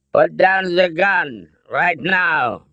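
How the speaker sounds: put-on high, slow German-accented order; commanding German accent. A narrator's reading. Colonel orders the gun down, thick German accent. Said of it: "the colonel ordered".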